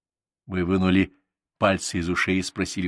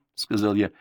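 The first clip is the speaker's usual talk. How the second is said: plain reading voice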